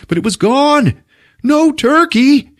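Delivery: high, loud hammed-up list; anguished mock lament. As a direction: cry out each loss with mounting grief. Quotes Ralphie's lament for lost turkey.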